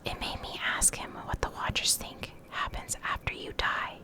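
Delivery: ordinary talk, nothing marked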